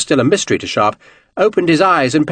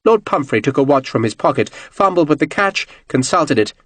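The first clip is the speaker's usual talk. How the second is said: plain reading voice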